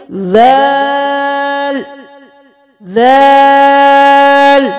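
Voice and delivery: ذال voiced with the tongue between the teeth, long and slow; soft dh sound. Shown as a demonstration. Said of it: "دانتوں کے درمیان زبان کو رکھ کے". (ذال نرمی سے، آہستہ کھینچ کر)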